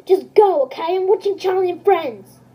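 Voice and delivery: loud, impatient brush-off; annoyed shout. Acted. Daddy yelling at Junior to leave him alone, annoyed shout. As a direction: shoo him off without looking away. Daddy waves son away from TV.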